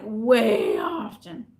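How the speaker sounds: WAY stretched out long and slow; emphatic stress. Real, not acted. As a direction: draw out "way" for emphasis. Stressing how often she talks about it.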